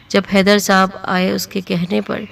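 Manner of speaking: ordinary delivery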